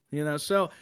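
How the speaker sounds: usual speaking voice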